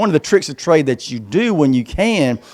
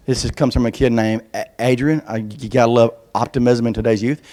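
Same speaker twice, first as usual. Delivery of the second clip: ordinary talk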